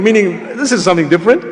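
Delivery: normal talking voice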